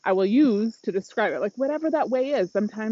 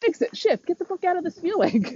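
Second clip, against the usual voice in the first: higher, pushy inner drill-sergeant voice; bossy self-talk; 7 semitones higher than usual. Retold or imitated. A coach mocking her controlling inner voice, pushy and higher. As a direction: bark orders at your own feelings, half-mocking. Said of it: "normally I'm like".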